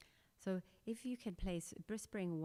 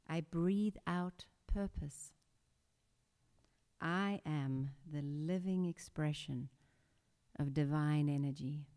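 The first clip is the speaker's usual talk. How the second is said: guided meditation. Acted. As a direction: guide the class through breath and intention. Leads a breathing meditation.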